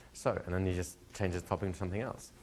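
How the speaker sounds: ordinary delivery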